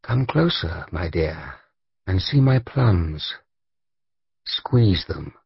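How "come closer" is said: usual speaking voice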